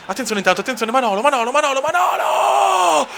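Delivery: rising shout, name yelled high; shouted goal call. Acted. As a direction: build to a screaming goal call.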